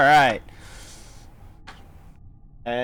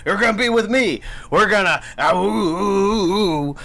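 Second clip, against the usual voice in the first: rousing choir leader; 3 semitones higher, 5 dB louder than usual. Acted. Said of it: "He's like".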